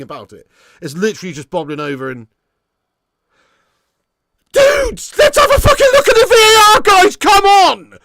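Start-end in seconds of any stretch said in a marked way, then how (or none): 4.5-8.0 loud high pleading yell; frustrated shout. Real, not acted. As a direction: demand the replay at full voice. Fan demands a VAR check.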